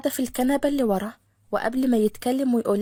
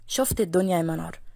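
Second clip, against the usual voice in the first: much lower, teasing echo; Khaled mocking; 7 semitones lower than usual. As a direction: throw her words back. (خالد بيرد كلامها بسخرية وصوت أوطى)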